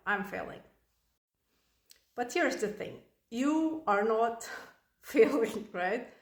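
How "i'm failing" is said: low, soft admission of defeat; defeated mom. Acted. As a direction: say it quietly, feeling like a bad mom. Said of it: "you feel like a crappy mom".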